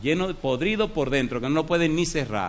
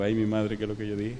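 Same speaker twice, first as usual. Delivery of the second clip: dismayed mutter; 6 semitones lower, 4 dB quieter than usual. Retold or imitated. Pastor's dismay, self-quote.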